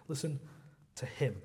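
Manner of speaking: "Listen", a pause, then "to him" set apart; stress on him. Shown as a demonstration. Preacher showing the italicized word, stress on "him" after a pause. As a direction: hold back, then land on "him" as if in italics. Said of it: "if you were trying to emphasize it".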